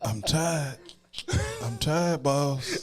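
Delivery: playfully anguished, worn-out plea; pained weariness. Pretend. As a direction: plead exhaustion to the boss, hammed up. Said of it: "hear the pain in my voice".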